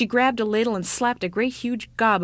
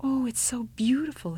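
sighing wonder; 4 semitones higher than usual. Acted. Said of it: "the ugly child sighed".

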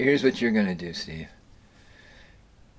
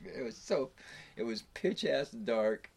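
ordinary talk

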